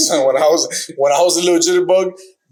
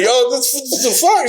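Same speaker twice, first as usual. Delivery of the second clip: higher-pitched, imitated startled reaction; mock bewilderment; 9 semitones higher than usual. Girlfriend to man collapsed on escalator, imitated bewilderment. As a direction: retell a startled what-on-earth outburst.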